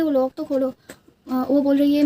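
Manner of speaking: ordinary delivery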